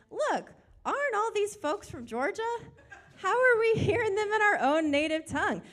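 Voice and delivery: high-pitched, astonished crowd member; excited amazement. Acted. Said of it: "were they excited, amazed to no end".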